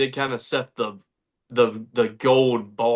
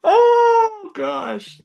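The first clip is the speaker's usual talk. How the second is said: high laughing gasp; 4 dB louder than usual. Real, not acted. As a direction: crack up at the joke. Laughing at the quote.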